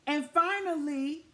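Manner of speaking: usual speaking voice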